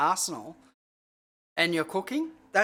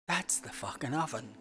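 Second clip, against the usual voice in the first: hushed; 3 semitones lower, 8 dB quieter than usual. Real, not acted. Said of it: "I don't know why I'm whispering".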